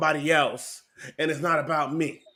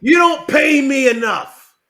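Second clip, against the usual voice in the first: higher, loud and slow; slow angry shout; 8 semitones higher, 10 dB louder, slower than usual. Acted. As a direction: bellow each word slowly. Ranting at the producer.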